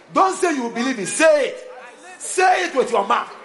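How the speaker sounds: high, loud preacher's exhortation; full-force preaching. Real, not acted. Preacher urging congregation to speak faith, shouted exhortation. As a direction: punch "say it" at the congregation.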